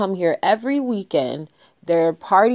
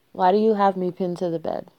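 ordinary delivery

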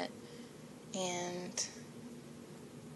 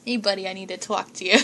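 put-on loud, fast Boston accent; hammed-up Boston guy calling out a buddy; 10 dB louder, faster than usual. Playful Boston accent, much louder, to her listeners. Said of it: "I love doing accents".

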